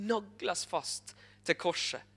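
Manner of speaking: ordinary talk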